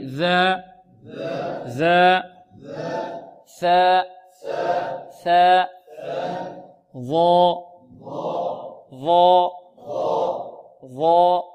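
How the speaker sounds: ذا ثا ظا with the tongue between the teeth, held; drilled tongue-tip letters. Shown as a demonstration. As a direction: call out ذا ثا ظا for the class to repeat. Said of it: "انطقوا معي". (نطق ذا ثا ظا بين الأسنان)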